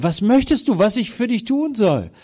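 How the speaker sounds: high, loud, open-hearted question; Jesus asking kindly. Acted. Jesus asks the blind beggar what he wants, loud and inviting. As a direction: call out the offer to help, inviting. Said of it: "dann sagt Jesus zu ihm".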